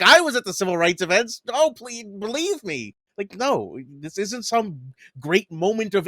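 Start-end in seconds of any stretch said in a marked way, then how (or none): 0.0-2.9 high, pleading mock Clinton; mocking Hillary Clinton. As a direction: whine it like a phony politician. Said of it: "when Hillary Clinton was like".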